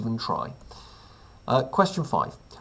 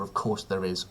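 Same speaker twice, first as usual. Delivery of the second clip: "Of course there is" with a lightly lowered, wry tone; dry sarcasm; 5 semitones lower, 5 dB quieter than usual. Acted. Host to viewers about the topless leader photo, dryly wry. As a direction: say it with a knowing sigh. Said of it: "of course there's a picture of him topless".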